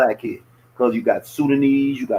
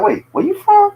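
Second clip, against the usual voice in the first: mock surprised question; 5 dB louder than usual. Mock surprised Canadian asking origin.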